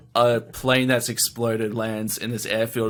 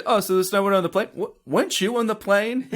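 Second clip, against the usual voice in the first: higher, quick dawning realisation; mock surprise; 9 semitones higher than usual. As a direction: ask as if it just dawned on you. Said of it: "Barton retold as a quote".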